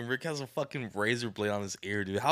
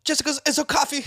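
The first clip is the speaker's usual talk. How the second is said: high, loud mock English accent; hammed-up English accent; Peaky Blinders accent; 7 dB louder than usual. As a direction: show off a silly British gangster accent. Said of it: "run that fucking little accent".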